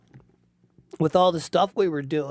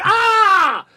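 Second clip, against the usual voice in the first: high, loud "ah" of burning pain; mock pain scream; 13 semitones higher, 9 dB louder than usual. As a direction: scream as if your eyes sting. Retells eyes burning from Tabasco.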